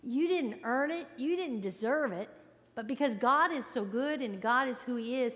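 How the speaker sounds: ordinary talk, nothing marked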